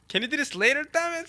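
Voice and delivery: high, loud irritated outburst; exasperated Roger. Retold or imitated. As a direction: shout it in mock exasperation. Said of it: "says Roger".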